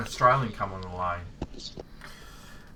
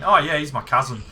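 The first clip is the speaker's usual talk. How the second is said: casual caller; 6 semitones higher, 11 dB louder than usual. Retold or imitated. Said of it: "And he went".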